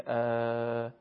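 ا stretched slowly yet kept short-quality; drawn-out short vowel. Shown as a demonstration. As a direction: hold the fatha long without turning it into â. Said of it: "هر چقدر که در زبان فارسی بکشید". (فتحهٔ کشیده و آهسته)

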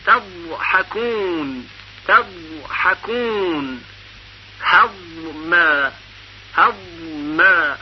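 تضحکون and هضم drawn out very slowly; slow recitation. Shown as a demonstration. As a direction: linger on each ض in slow recitation. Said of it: "مثال دیگر".

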